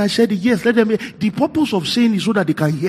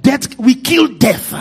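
rousing preaching; 8 semitones higher, 6 dB louder than usual. Acted. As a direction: build it up from the pulpit, word by word. Preaches bold declarations.

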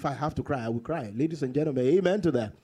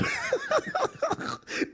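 high-pitched burst of laughter; real laugh. Real, not acted. Preacher laughs at his own blunt reply, amused laugh.

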